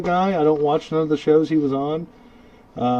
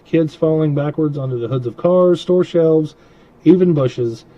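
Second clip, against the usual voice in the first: ordinary delivery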